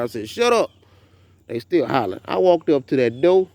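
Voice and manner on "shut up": slightly raised retold command; a little louder. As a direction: firmer mock scold, a bit louder. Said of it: "I said a little louder".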